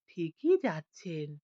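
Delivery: a silky murmur from the dark; soft velvety voice. A narrator's reading. Unseen voice in the forest answers the lost man softly. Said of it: "অমনি সেই মোলায়েম গলা".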